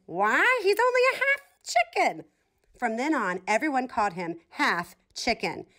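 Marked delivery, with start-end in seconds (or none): 0.1-2.3 much higher, mocking turkey. A narrator's reading. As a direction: sneer like a gossipy turkey, pitched way up. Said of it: "one of the turkeys added".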